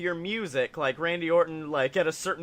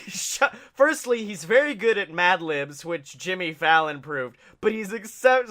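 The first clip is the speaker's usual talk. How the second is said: ordinary talk, nothing marked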